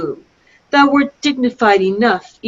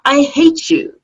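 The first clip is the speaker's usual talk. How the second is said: spat hatred; 7 semitones higher than usual. Acted. Princess rejects Cloten.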